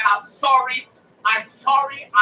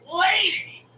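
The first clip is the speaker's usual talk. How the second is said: exasperated; 9 semitones higher than usual. Retold or imitated. Acted retort to phone agent.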